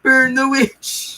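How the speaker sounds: high, slow mob shriek; angry mob shout. Pretend. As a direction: screech it like a peasant mob. Monty Python mob quote.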